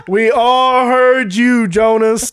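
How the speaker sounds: loud, slow group chant; crowd in unison. Acted. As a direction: chant it slowly like a whole bar answering. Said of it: "like, in unison".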